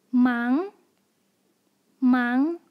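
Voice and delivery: 忙 as one joined syllable, rising; each 忙 climbing like a question; second tone. Shown as a demonstration. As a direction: sweep the pitch upward through 忙. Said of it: "second tone".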